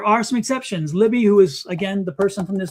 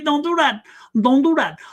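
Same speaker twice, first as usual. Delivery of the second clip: firm warning; 6 semitones higher than usual. Real, not acted. Scolding Lisa for blocking spirits.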